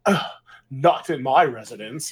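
slow, disdainful put-on voice; mock-haughty elder turning up their nose, slowly; snooty older person. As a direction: sniff at it like a stuffy boomer. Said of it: "they'd probably be like".

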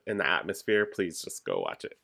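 ordinary talk, nothing marked

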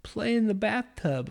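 high whiny toddler voice, pleading to keep playing; little son protesting. Dad imitating his son begging to stay in the bathtub, high and whiny. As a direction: play the kid who won't leave the bath. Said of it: "And he goes".

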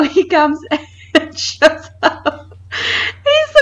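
high voice breaking up with laughter; cracking up mid-sentence; laughing while talking. Real, not acted. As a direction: try to tell the story through real giggles. Cracking up while telling the story.

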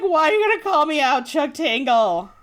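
high, loud mock outrage at being called out; mock indignant. Fan pretending the author called her out, mock indignant. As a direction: squeal it like you've been caught. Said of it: "And it's like".

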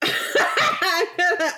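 slow, laughing through words. Real, not acted. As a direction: laugh while talking. Laughing about cheesecake.

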